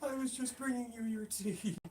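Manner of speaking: high, slow whiny put-on voice; silly high voice. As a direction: squeak it like a feeble old man. Voicing a victim comically.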